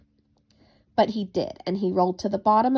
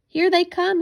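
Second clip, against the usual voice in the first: high, loud excited call; children calling out with joy; little rabbits spotting the owl, excited cry; 10 semitones higher, 6 dB louder than usual. A narrator's reading. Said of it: "they all cried at once".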